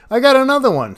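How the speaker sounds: loud, high burst of excitement; excited shout. Real, not acted. Angler feels a bite on the beach, excited shout. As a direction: yell it out as the rod bends. Hooks another fish, shouts.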